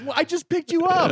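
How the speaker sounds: high mock-exasperated voice. Storyteller mimicking himself, exasperated, in a high voice. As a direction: play it up, high and fed up. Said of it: "I'm like".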